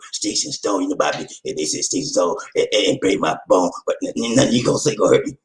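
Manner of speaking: very low, softened mimicry of a saying; mock objector. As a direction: mumble the old saying in a put-on low voice. Mimicking those who quote the saying.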